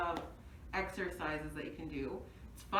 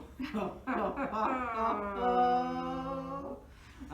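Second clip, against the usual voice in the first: loud, arguing laugh at someone; argument laughter; 3 semitones higher, 3 dB louder than usual. Shown as a demonstration. Instructor demonstrating argument laughter, laughing as if arguing. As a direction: laugh as if mid-quarrel. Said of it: "argument laughter looks like this".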